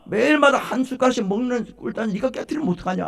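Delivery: loud, high-pitched scolding outburst; acted shout at a careless daughter-in-law; angry mother-in-law. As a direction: start with a yell, then keep berating. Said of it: "화가 나서".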